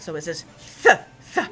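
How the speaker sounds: "th" said as a long breathy th with the tongue between the teeth; teacher showing how "th" sounds; th sound demo. Shown as a demonstration. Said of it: "it doesn't say T like the T says".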